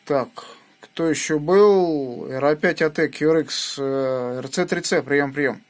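ordinary talk

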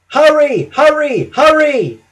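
high, loud urgent puffs; urgent puffing. A narrator's reading. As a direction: puff it out in a rush. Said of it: "he puffed".

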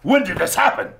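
high, loud aristocratic outrage; Thurston the thirty-third. Acted. Aristocrat hearing of a kidnapping, loud appalled exclamation. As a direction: exclaim through clenched teeth, appalled. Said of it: "aristocratic mien of talking through his teeth".

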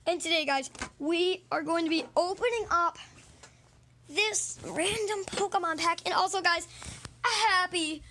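high, loud, hyped host greeting; big excited welcome to the viewers; video intro. Pretend. Host opening a video for viewers, high and loud.

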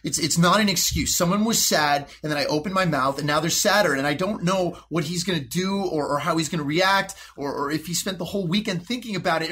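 usual speaking voice

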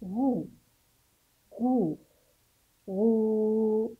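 "غو" as a separate, drawn-out ghuu; ghayn with damma. Shown as a demonstration. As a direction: sound out "غو" with a long rounded oo. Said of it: "كيف سننطق حرف الغين". (نطق "غو" بغين مضمومة ممدودة)

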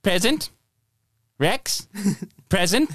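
put-on high New Zealand-accented clone answering roll; roll call voice. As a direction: pipe up high in a Kiwi drone.

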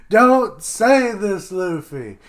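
loud, high, drawn-out protest; exasperated cry. Real, not acted. Reader scolds Luffy for his taunt, exasperated cry. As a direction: cry out in dismay at a friend's blunder.

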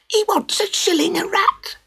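very high, squeaky passing-on of news; gossiping crowd. Acted. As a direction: tell the next person, shocked. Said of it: "swept through the crowd in whispers".

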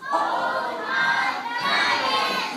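long, slow, high drawn-out call; a slow, high summoning call. Acted. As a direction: call the giant from far away, stretching every word. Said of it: "He called out".

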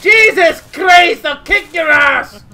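loud, drawn-out outburst; exasperated threat. Pretend. Threatening a player begging for meat, loud slow outburst. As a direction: shout a slow threat in outrage.

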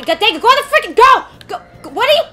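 high, loud frustrated shouts; yelling. Real, not acted. Yelling at game.

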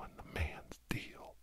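ordinary talk

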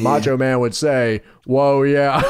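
lightly voiced low old wrestler drawl; a hint of a gruff veteran wrestler; Lanny Poffo impression. Mocking old wrestlers to friends, a light low wrestler voice. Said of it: "they sound like Lanny Poffo".

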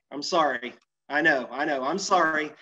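ordinary delivery

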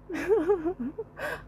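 soft, high squeaky laugh of scorn; scornful laugh. Real, not acted. Taunting the fan Tiến, a soft mocking giggle. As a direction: laugh him off with a thin giggle.

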